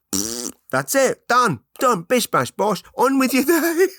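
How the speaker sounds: high, loud, hammed-up sign-off; mock satisfied listener. Comedian playing a fart-podcast listener, high mock-brisk cheer. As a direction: rattle off a mock-triumphant sign-off.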